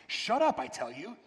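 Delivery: sharp, high jump on the first words; impatient snarl. A narrator's reading. Ghost to the whispering lizard, impatient snarl. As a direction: snap impatiently, then drop. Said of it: "with a snarl of impatience".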